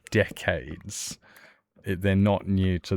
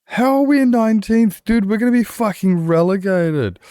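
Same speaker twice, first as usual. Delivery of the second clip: very high, loud freaking-out yell; panicked shout; 13 semitones higher, 8 dB louder than usual. Retold or imitated. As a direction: scream in horror at nineteenth place. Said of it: "and I was like".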